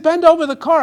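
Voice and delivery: loud police order; barked order. Acted. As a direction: bark it like a cop. Cop orders him at roadside.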